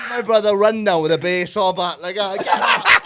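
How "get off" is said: high, loud laughing shout; frantic yell. Retold or imitated. Brother charging at the neighbour, high laughing yell. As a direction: scream it as you run in.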